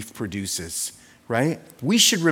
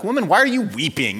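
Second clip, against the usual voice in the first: impatient and fed up, a touch higher; exasperated modern tone; 6 semitones higher, 4 dB louder than usual. Shown as a demonstration. Preacher showing how we'd say it now, exasperated. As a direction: sound tired of her tears. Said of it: "Not like we would say it now".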